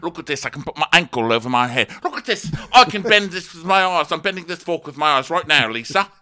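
high, loud manic bragging; hammed-up boasting. [name] bragging to Lisa, high and loud. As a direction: show off like a man gone giddy.

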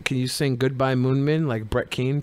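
ordinary talk

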